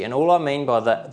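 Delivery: normal talking voice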